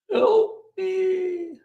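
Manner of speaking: very high, hammed-up cry for help; mock whimper. Vlogger pleads to viewers in a joking whimper. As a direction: squeak it out like a pitiful victim. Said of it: "how else can I say".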